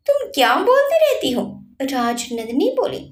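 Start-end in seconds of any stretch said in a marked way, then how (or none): 0.1-1.4 irritated. Acted. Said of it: "Virat चिढ़ते हुए बोला".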